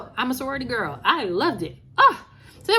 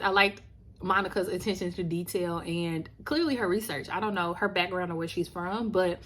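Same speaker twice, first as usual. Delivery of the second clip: usual speaking voice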